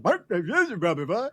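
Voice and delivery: garbled seal-bark gibberish. Retold or imitated. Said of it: "hears me barking like a seal".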